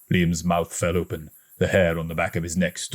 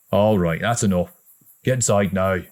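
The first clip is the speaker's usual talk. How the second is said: plain reading voice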